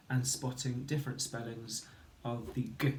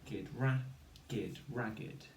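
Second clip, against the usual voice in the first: sounding out; 4 dB quieter than usual. Shown as a demonstration. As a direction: model each sound, then blend the word. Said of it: "So we say".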